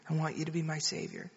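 quick, soft imitation of a child repeating; retold child's low, hurried repeat; child echoing prayer. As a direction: echo the prayer line quickly and quietly. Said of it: "You just pray after me".

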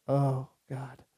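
low groan of dismay; hammed-up sinking horror; mock dread. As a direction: let it sink out, low, as if all is lost. Acts out seeing phone land face down.

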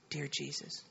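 very soft, low imitation of a child repeating; retold child's hushed repeat; child echoing prayer. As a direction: echo the prayer line in a tiny hushed voice. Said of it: "You just pray after me".

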